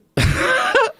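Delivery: high, sudden burst of laughter; real laugh. Real, not acted. Storyteller cracking up before the love song, high laugh.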